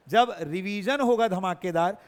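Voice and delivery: usual speaking voice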